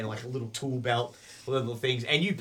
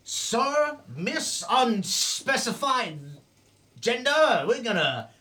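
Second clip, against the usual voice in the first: high, slow mock-courteous goblin voice; overly polite goblin; 9 semitones higher, 6 dB louder, slower than usual. Goblin apologizing to the owner, high mock-polite voice. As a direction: grovel with fake manners.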